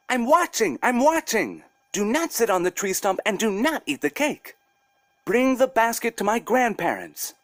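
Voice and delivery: put-on high sing-song girl voice, stern warning; a hidden girl scolding the bear; little girl Masha. Masha from the basket warning the bear, high stern girl voice. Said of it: "Masha replied from inside the basket".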